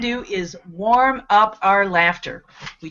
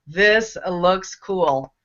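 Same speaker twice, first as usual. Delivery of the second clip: slow repeat; 5 semitones lower, much slower than usual. Retold or imitated. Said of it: "Dave Moore gave us".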